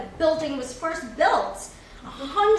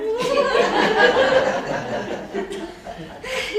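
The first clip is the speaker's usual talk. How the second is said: big loud laugh; 8 semitones higher, 6 dB louder than usual. Acted. Laughing at Edwina's insult.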